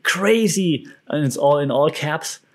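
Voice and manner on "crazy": high mock hype shout; clickbait hype voice. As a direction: sell the word like clickbait.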